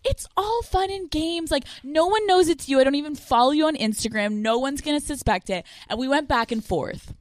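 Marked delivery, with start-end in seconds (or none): 0.0-5.6 higher-pitched retold reassurance; placating. Woman soothes exposed lover, breezily placating. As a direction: brush it off in a light, high imitation of herself. Acting out her placating reply.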